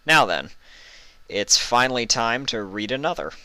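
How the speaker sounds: ordinary delivery, nothing marked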